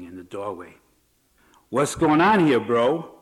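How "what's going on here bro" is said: loud called greeting; calling out. Acted. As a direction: call it out across the room. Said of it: "A familiar voice called out".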